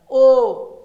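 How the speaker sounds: ओ said out loud and drawn from the throat with rounded lips; vowel demo. Shown as a demonstration. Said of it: "देखिए यहाँ पे भार पड़ेगा". (ओ को गले और होंठों से ज़ोर से बोलकर दिखाना)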